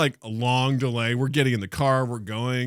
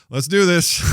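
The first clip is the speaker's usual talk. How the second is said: pumped rally; 5 semitones higher, 6 dB louder than usual. Retold or imitated. Rallying before recording.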